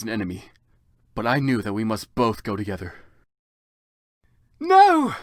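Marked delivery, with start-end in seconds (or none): 4.6-5.1 very high, loud cry; very high, loud scream; shouted no. Acted. Vampire cries out.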